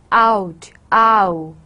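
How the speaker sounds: OUT with a full open ah-oo glide; standard American OW. Shown as a demonstration. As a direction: draw out the ow in OUT. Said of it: "It's ow sound in standard American".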